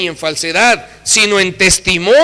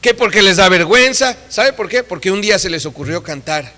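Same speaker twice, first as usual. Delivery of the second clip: ordinary talk, nothing marked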